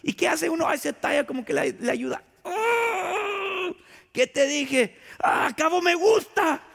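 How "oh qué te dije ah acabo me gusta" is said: brother's pained cry. Retold or imitated. Little brother hurt on the table, crying out to mom. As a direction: yelp like a kid who just hit his head. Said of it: "Es lo que dijo mi hermano".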